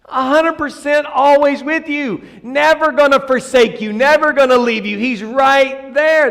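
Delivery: high, insistent preacher's cadence; fervent preaching. Real, not acted. Preacher assuring church God is with them, high and insistent. Preacher builds on God's presence.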